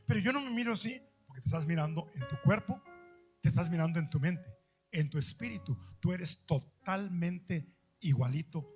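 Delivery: normal talking voice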